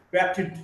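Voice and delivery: batted in two syllables, ending id. Shown as a demonstration. Teacher showing a past ending, batted with id. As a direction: sound out the final id in batted. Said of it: "D or E D is pronounced as id".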